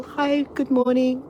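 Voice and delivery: usual speaking voice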